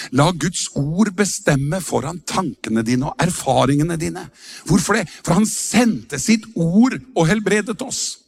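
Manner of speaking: normal talking voice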